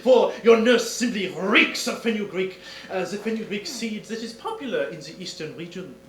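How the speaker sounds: lightly hammed French detective, pleased with himself; French accent. Detective showing off to the countess, French accent. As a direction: lay out the deduction with Gallic flair.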